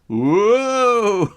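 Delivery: loud high whoa; loud, high startled whoa; surprised whoa. Pretend. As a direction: yelp as the board shoots off. Sudden skateboard slip.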